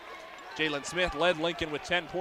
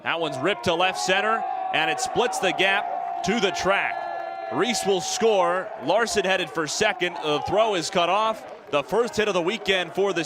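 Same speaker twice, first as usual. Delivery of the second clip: loud, rising play-by-play shout as the ball splits the gap; excited hit call; 3 dB louder than usual. Real, not acted. Radio announcer calling a Gators RBI double for listeners, loud and thrilled. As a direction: call the double at full voice, racing the runners.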